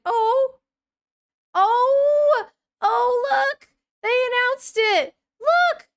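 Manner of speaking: high, loud squeal of delight; excited shriek. Real, not acted. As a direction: shriek with joy at the news. Thrilled at big announcement.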